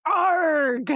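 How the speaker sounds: loud hammed-up "Arg" groan; loud mock groan. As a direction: belt out a silly pirate-like groan. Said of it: "Anna's sex sounds".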